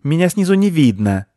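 high, loud squealing squirrel voice. Acted. Squirrel squeals at the children. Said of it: "заверещала Белка".

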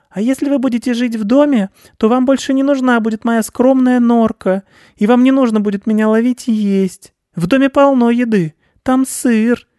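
very high, loud squeaky mouse voice; eager little mouse making a pitch; coaxing mouse. Acted. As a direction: tempt the baron in a tiny piping voice.

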